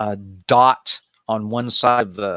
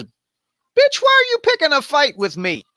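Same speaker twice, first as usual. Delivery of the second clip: much higher put-on voice, fast and indignant; mock angry Jephthah. Jephthah confronting the Ephraimites, high mock outrage. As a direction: snap at them in a shrill cartoon squeal. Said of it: "He says".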